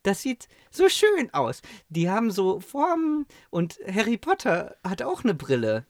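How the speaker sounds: put-on high pleading kid voice; whiny child. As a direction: beg like a little boy wanting glasses. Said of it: "ich war richtig am Quengeln".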